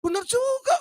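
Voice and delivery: very high, amused exclaim; amused agreement. Retold or imitated. The preacher concedes the madman's point, high amused. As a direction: grant it with surprise. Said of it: "bilang gitu ya".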